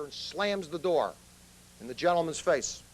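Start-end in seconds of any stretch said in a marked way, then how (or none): none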